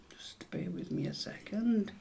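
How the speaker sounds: drops to a whisper off-mic; quick hushed aside while searching. Real, not acted. Quizmaster whispering an aside to the quiz players.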